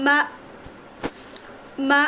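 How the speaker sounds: "মা" cut short on a glottal stop, a pause after each; sukun drill. Shown as a demonstration. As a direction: stop "মা" abruptly on the hamza. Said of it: "জজমের উচ্চারণের সময় মাখরাজ মিলিত হয়ে যায়". ("মা" হামজা সাকিনে হঠাৎ থামিয়ে)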